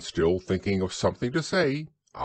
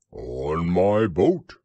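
slow, loud, growly boast; proud growly bear; 5 dB louder, much slower than usual. A narrator's reading. Pooh telling how he got here, proud. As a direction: declare it proudly in a bear's voice. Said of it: "said Pooh proudly".

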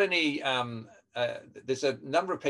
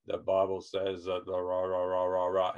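lower, dull droning with no tune; one-note boring read; monotone; 4 semitones lower than usual. Shown as a demonstration. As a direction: drone it like you're bored. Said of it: "a real monotone, boring sound".

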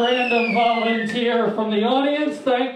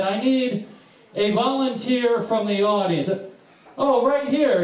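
ordinary delivery

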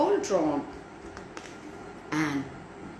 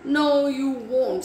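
loud, high, slow snapped reply; sharp storybook scolding, raised voice; snapping; 6 semitones higher, 6 dB louder, slower than usual. A narrator's reading. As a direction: snap back firmly, drawing it out. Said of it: "snapped Sticklewick".

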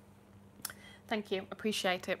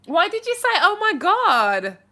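high, loud, slowed "Oh my God"; surprised question; 9 semitones higher, 9 dB louder than usual. Real, not acted. Streamer asking a viewer about their comment, surprised. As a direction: tease them with mock disbelief.

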